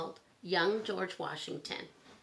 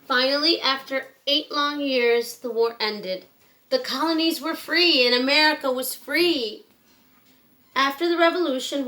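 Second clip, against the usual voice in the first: louder and higher, celebrating the victory; triumphant storytelling; 8 semitones higher, 13 dB louder than usual. Acted. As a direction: let the freedom moment ring out proudly. Triumphant climax of the war.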